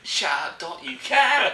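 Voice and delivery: high, hammed-up EastEnders row; screechy mock Cockney telling-off; London accent. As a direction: scold in broad soap-opera London. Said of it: "Dot from EastEnders".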